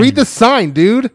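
loud, high indignant telling-off; worked-up retold yell; exasperated shout. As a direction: yell it at someone ignoring the rules. Replays telling man off.

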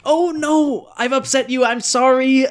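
high, alarmed oh no into a hurried sorry; flustered apology. Acted. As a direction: panic that you made him cry. Fascist starts sobbing again.

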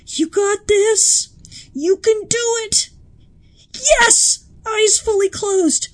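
high, loud coaching cheers; pep-talk the eyelids; cheering coach. Pretend.

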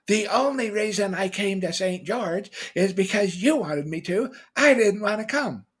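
high, loud mock tirade; imagined wife yelling in the stepmother's manner; angry wife. Wife throws the move back at husband, loud high-pitched mock outburst. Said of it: "What if my wife said to me".

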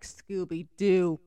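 usual speaking voice

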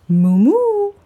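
high, drawn-out moo; mooing cow. A narrator's reading. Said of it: "answered the cow".

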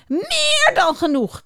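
high, slow, crying shriek. Acted. The beaver shrieks at Juniper, high and slow. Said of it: "krijst de bever".

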